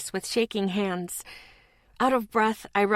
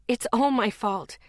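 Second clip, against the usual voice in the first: ordinary delivery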